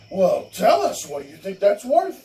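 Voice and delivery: hammed-up judge egging her on; mock indulgent judge. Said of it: "he says".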